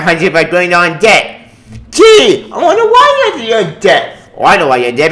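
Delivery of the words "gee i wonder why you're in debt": high, slow mock wonder; mock innocent wondering, pitched way up; sarcastic gee. Ranting vlogger mocks AT&T's debt with sarcastic wonder.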